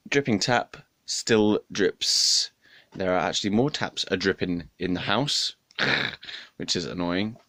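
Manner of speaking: ordinary talk, nothing marked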